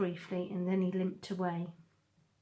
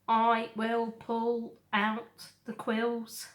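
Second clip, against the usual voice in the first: calm robot; 3 semitones higher, 4 dB louder, slower than usual. A narrator's reading. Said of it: "Roz calmly knelt".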